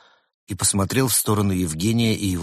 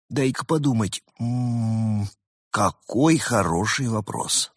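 mock pondering; 3 semitones higher, slower than usual. Character mulls the question.

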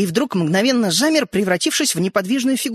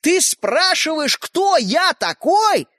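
much higher, slow, loud outraged cry; indignant outburst; 8 semitones higher, 3 dB louder, much slower than usual. A narrator's reading. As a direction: draw out the disbelief and shout it. Toy man outraged.

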